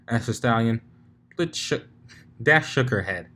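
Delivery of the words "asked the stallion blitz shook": ordinary delivery, nothing marked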